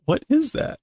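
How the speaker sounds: high, startled question; puzzled, rising "what is that"; surprised. Acted. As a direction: jump at a strange noise. Startled by the strange noise.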